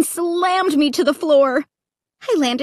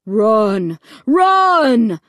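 loud announcer voice over a speaker; booming loudspeaker; 3 semitones lower, 4 dB louder than usual. A narrator's reading. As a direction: boom it through a loudspeaker. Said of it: "the voice on the loudspeaker boomed".

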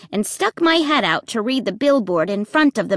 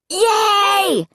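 high, drawn-out cheer; excited scream; 11 semitones higher than usual. A narrator's reading. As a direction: squeal it out in triumph, pitch way up. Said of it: "Luke screamed".

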